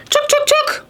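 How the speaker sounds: very high squeaky chattering; squirrel chatter. Acted. Mama Squirrel keeping visitors away in very high chatter.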